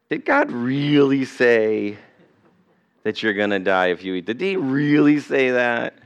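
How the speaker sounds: a hint of the tempting devil, sly and doubtful; serpent's sly question. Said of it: "what the devil said to Eve".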